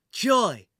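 loud barked call to a henchman; yelling. A narrator's reading. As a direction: bark the name out. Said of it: "the man yelled".

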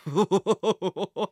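villain's mocking chuckle; a string of wicked ha-has; nasty laugh. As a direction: laugh nastily at the captive. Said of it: "The man laughed nastily".

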